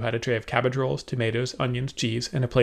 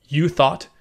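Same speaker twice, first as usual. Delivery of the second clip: sharp, touchy echo, a little louder; irritated retort; 4 semitones higher, 6 dB louder than usual. A narrator's reading. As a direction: bristle at the words. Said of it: "His temper was up".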